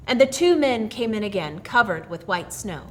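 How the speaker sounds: ordinary delivery, nothing marked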